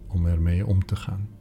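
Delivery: ordinary talk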